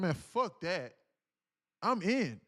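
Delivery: loud, high and eager; excited agreement. Retold or imitated. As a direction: sold on it. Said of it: "he was like".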